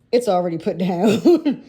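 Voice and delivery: slow, amused laugh over a craft mistake. Real, not acted. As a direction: laugh at your own slip. Laughs at her own mistake.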